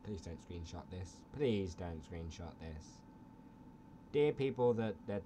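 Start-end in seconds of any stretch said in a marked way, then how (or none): 0.0-1.1 low, hushed plea. Pretend. Vlogger begging viewers not to screenshot, low soft plea. Pleads, then repeats it slower. 1.4-3.0 slow, drawn-out low plea. Pretend. Vlogger begging viewers not to screenshot, slow drawn-out plea.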